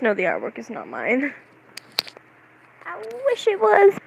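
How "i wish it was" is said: wistful wish. Real, not acted. Wishing the fan art was their own, wistful. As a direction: wish it aloud in a much higher voice. Wistful remark about the artwork.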